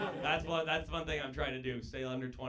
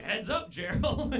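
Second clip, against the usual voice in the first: much higher, slow call across court; old-timey player; 10 semitones higher, 6 dB louder, slower than usual. Retold or imitated. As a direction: call it out like a fifties ballplayer.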